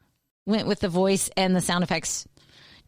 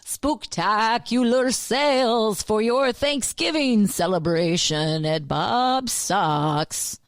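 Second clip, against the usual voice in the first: slow, drawn-out spooky ad read; 2 semitones higher, much slower than usual. Shown as a demonstration. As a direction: stretch it like a haunted announcer. Co-host joins spooky ad spoof.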